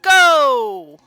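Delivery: loud, high contest announcer call; booming prize announcer. Acted. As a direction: call the race like a rodeo announcer. Reads contest announcer lines.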